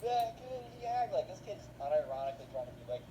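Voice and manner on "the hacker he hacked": put-on higher, slow pleading kid; a hint of a kid making excuses; a kid in a video pleading to his mom, lightly voiced; lightly voiced whiny kid. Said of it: "the kid's like".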